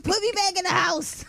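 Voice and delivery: high pleading through laughter; laughing while quoting. Retold or imitated. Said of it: "I said".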